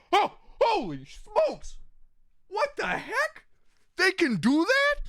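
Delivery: high, slow squeaky astonishment; a hammed-up gasp of wonder; mock amazed God. Streamer voicing God stunned by flying humans, high and slow.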